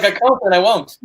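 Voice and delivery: playful imitation of a refusal; imitated refusal. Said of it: "She's like".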